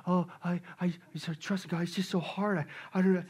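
soft, low, halting complaint; hesitant doubter. Acted. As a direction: act out weary, faltering doubt. Acts out a hesitant believer.

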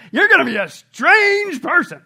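slow, loud, high proclamation; emphatic declaration. Real, not acted. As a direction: proclaim it to the whole church. Emphatic pronouncement.

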